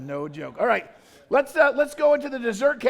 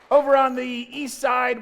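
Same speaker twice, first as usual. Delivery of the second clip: loud call to the crowd; 4 semitones higher, 7 dB louder than usual. Real, not acted. Host calling out the bracket to the crowd, loud. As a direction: boom it out to the room.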